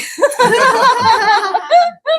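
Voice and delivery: giggling laugh; high laugh. Real, not acted. Laughing at her own retold panic, high giggle. Laughing after daughter quote.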